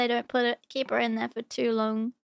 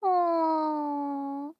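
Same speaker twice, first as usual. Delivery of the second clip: teary aw. Real, not acted. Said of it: "this song makes me cry".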